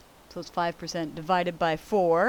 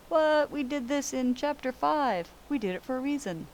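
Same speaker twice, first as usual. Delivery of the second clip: mock surprise; 7 semitones higher than usual. Mock surprise at natural log.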